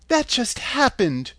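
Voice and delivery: put-on high, slow child pleading; little girl voice. A narrator's reading.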